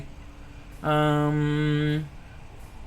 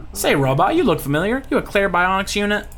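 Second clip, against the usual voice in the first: lightly higher Louisiana character voice; Louisiana accent; 6 semitones higher than usual. Acted. Pirate questioning the robot, Louisiana accent. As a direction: ask it as a folksy Louisiana local. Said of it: "slipping into the accent".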